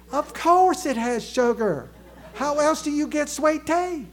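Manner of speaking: drawling, indignant Southern voice; Southern accent. Acted. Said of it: "Can you imagine that accent".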